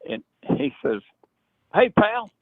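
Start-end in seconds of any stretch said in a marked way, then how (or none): 1.7-2.3 imitating an excited friend, much higher and louder at first; a high, eager mock greeting. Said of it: "He gets all excited".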